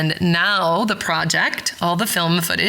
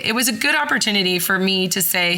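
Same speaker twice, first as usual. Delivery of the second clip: normal talking voice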